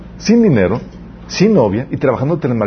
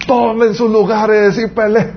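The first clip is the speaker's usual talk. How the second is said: hammed-up commanding wizard, much higher and slow, with a laugh; commanding Gandalf; 9 semitones higher, slower than usual. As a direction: bark the order like a wizard rallying troops. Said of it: "le da un trancazo y dice".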